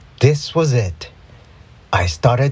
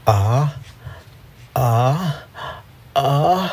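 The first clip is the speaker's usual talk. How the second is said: pre-sneeze gasps; 2 semitones higher, much slower than usual. A narrator's reading. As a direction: sneeze building up in rising uhs. Building up to a sneeze.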